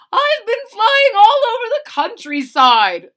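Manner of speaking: excited blue jay. Acted. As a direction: boast like a bird who has seen it all. Blue jay voiced in story.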